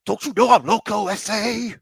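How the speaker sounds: put-on high, loud comic take on Kaiser's accent. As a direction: imitate Kaiser's accent, hammed up in someone's face. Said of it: "Kaiser and his accent".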